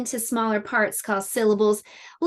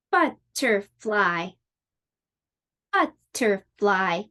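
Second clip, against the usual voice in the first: slow Butterfly, each syllable spaced like a clap; clapped syllables; 3 semitones lower, slower than usual. Shown as a demonstration. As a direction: say Butterfly slowly, one beat per syllable. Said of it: "How many times did I clap?".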